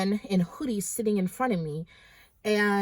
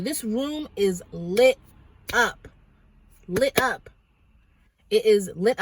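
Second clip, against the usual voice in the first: "lit up" repeated, louder each time; stress on lit up; 5 dB louder, slower than usual. Real, not acted. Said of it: "let me tell you".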